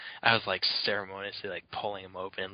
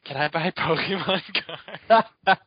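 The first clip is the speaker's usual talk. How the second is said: put-on higher, louder, giggling shy kid voice; nervous kid asking; 9 semitones higher, 8 dB louder than usual. A kid on the phone asks the card shop lady, nervous and giggly. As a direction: stammer it out like a shy eleven-year-old. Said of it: "I'm like".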